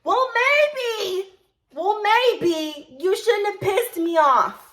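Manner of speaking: very high, loud, drawn-out comeback; angry retort. Retold or imitated. Acted retort to manager's scolding.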